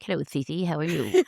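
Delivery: low, hushed pet-talk voice; soft, silly voice for greeting a pet; talking to a cat. Shown as a demonstration. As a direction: coo softly and low to your cat. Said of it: "how I used to talk to my cat".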